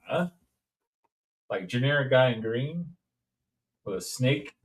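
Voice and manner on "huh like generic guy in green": usual speaking voice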